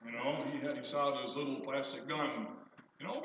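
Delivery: usual speaking voice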